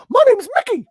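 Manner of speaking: high squeaky cartoon mouse; Mickey Mouse voice. Showing off impressions to friends, high cartoon mouse voice. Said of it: "the one before that was".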